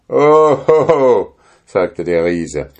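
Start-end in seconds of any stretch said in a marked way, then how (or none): 0.1-1.4 giant's scornful laugh. A narrator's reading.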